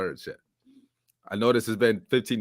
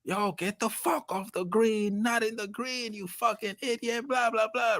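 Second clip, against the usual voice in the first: yelling golfers; 11 semitones higher, slower than usual. Retold or imitated. Man retelling a golf trip, mocking the golfers yelling at the driver. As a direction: play the golfers shouting at the driver, high and drawn out.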